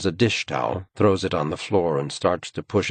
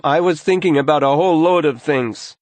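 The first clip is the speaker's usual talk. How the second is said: louder, raised-voice reply; loud teen retort; 10 semitones higher, 8 dB louder than usual. A narrator's reading. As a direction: snap it back at her. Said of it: "he says loudly".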